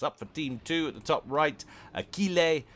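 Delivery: put-on French-accented name, pitched high and loud. Commentator announces a player's name, struggling not to sound French, French accent. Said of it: "put on the French accent".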